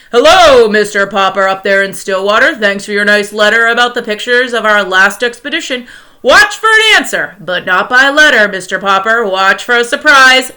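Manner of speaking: Admiral Drake on the radio. Acted. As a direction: announce it like a crackling radio. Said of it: "a faint voice floated into".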